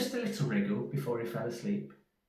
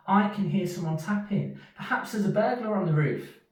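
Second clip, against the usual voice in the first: high, worried little mouse; a small mouse scared of a burglar; 9 semitones higher, 6 dB louder than usual. Acted. Little Mouse warns Big Mouse of a burglar: high frightened voice.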